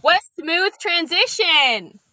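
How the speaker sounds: high, loud, drawn-out exclamation; exclaimed mock praise. Real, not acted. Guest teasing host about his clumsy segue, exclaimed high and slow. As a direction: tease with a big, slow cheer.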